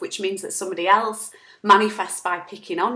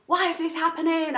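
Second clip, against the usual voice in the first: mock angry outburst; 7 semitones higher than usual. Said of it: "in that moment of".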